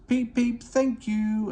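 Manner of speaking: high, mock whistle peep; whistled peep. A narrator's reading. Thomas whistling thanks to waving signalmen, high and bright. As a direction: toot it out high and cheerful. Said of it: "And he whistled".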